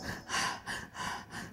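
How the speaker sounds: long audible exhale; demonstration sigh. Shown as a demonstration. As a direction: let a big breathy sigh out. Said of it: "Others overbreathe".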